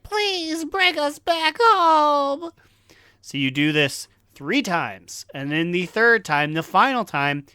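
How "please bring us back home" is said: high, slow, pleading plea; pleading dragon voice. Retold or imitated. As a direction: whine it like a tiny dragon. Voicing the dragons.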